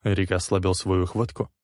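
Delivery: usual speaking voice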